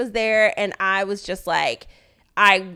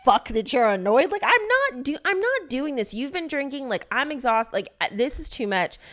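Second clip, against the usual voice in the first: higher-pitched fed-up protest, easing down; retold exasperation; 6 semitones higher than usual. Wife retelling her late-night fight with him, exasperated. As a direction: start high and indignant, then tire out.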